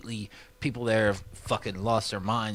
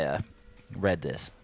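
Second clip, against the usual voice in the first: ordinary talk, nothing marked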